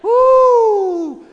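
high, loud church whoop; hammed-up high woo. Parent faking excitement in the parking lot, a loud high woo. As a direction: throw out a showy high woo. Exaggerated cheer.

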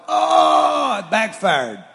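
high, loud oh no; dad realising the dog ignores him; dismayed shout. Retold or imitated. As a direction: shout it as the plan turns on you. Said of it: "I'm like".

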